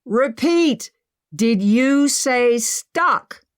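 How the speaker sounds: loud, high and slow shout. Acted. Emma shouting up to Henry, loud and slow. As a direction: shout the question up at the ceiling. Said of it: "Emma shouted at the ceiling".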